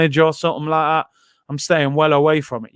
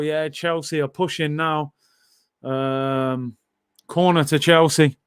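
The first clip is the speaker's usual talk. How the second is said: ordinary talk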